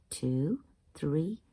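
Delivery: plain reading voice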